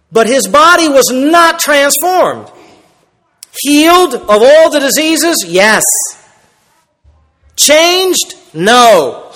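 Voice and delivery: high, slow preacher's question and answer; emphatic preaching. Real, not acted. Preacher asking and answering himself for the congregation, emphatic. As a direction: preach it hard, drawing out the key words.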